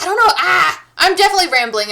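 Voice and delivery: high, loud burst of exasperation; flustered outburst. Real, not acted. Flustered, catching herself rambling.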